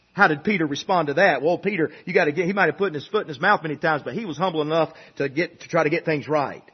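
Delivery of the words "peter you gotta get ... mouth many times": ordinary delivery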